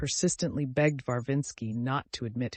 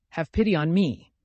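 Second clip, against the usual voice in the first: louder, raised reproachful plea; bitter reproach; 3 semitones higher, 7 dB louder than usual. A narrator's reading. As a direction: throw the plea back bitterly. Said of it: "Katya said with bitter reproach".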